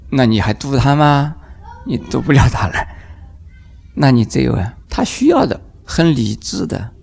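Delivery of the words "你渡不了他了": ordinary talk, nothing marked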